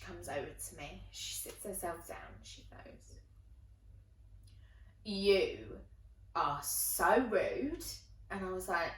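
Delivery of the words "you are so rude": very slow, spaced-out telling-off; slow reproach. Retold or imitated. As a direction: spell out the disapproval word group by word group. Friend scolds her, first delivery.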